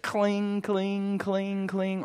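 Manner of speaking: high, drawn-out clanking guitar noise; clunky guitar imitation. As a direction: imitate a badly tuned guitar, slowly. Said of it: "it was very bad quality".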